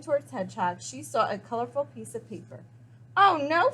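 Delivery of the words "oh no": high, alarmed cry. Acted. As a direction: call out in alarm. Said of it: "she cried".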